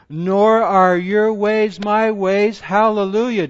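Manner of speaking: loud, slow, ringing scripture read; preaching at full force. Acted. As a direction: proclaim it to the back row.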